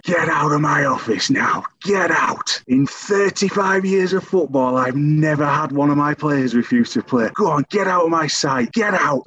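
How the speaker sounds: hammed-up manager losing it at a player; went berserk. Manager throwing his player out of the office, imitated furious yelling. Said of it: "he just went berserk".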